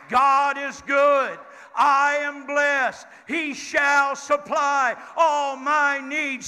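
loud, slow congregational chant; shouted affirmation. Shown as a demonstration. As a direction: shout it out with the church. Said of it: "Would somebody shout that with me?".

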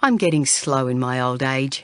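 a hint of an old man owning up; weary grandfather. A narrator's reading. Grandfather admitting he missed the signs, lightly voiced and rueful.

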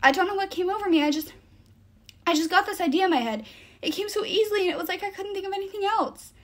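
high, rushed, upset explanation; pleading, pitch held high; distraught apology. A narrator's reading. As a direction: babble it out on the verge of tears. Tearful apology pouring out before sobs.

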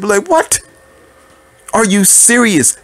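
high, disbelieving what, are you serious; incredulous. Real, not acted. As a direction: react like you can't believe your ears. Incredulous reaction to advice.

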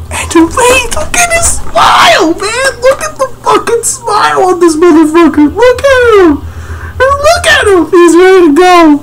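very high-pitched excited squealing; hyped freak-out. Real, not acted. Manga reader reacts to the smile, high excited squeal. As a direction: squeal in disbelief, far above your normal voice.

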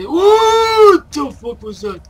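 high loud frightened cry; startled yell. Real, not acted. Sudden noise in the mine.

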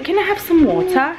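high-pitched imitation of a child's British accent. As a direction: mimic a little kid trying British English. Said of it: "empezó a hablar con el acento de Inglaterra".

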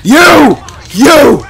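high, loud accusation; furious gamer yelling at a rival; angry shout. Real, not acted. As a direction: hurl it at him. Yelling at opponent.

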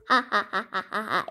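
staccato "ha ha ha"; acted laughter. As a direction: laugh helplessly from laughing gas. Hit by laughing gas.

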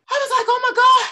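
high, breathless amazement; overwhelmed. Retold or imitated. Woman hugged by boy, stunned and high.

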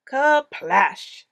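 big loud kerplash; loud splash. A narrator's reading. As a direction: burst it out loud. Splash sound effect word.

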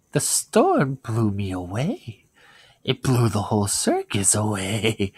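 creepy clown voice, pitch leaping up on the friendly lines; new Pennywise voice. Pretend. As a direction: lure the child in with a sing-song clown voice. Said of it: "do the new one".

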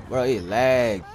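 ordinary delivery

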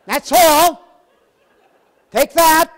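high, loud, slow mock miser; stingy Dickens keeper. Preacher mocking Oliver Twist's keeper, high and loud. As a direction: ham up a Dickensian refusal. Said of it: "I felt like saying".

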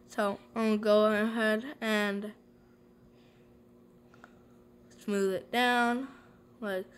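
fingers in mouth, lips parted; speaking with foil on teeth. Real, not acted. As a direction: teeth covered, slightly muffled words. Seen 0: Foil covers teeth; fingers press it into place while talking.

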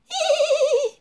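high whinny; shrill whinnying horse; horse neigh. A narrator's reading. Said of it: "Hennit Hannibal".

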